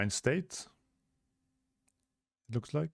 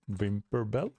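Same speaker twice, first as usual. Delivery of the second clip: ordinary delivery, nothing marked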